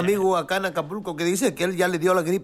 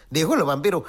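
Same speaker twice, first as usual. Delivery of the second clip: usual speaking voice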